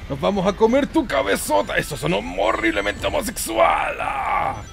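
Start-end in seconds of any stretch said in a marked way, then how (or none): none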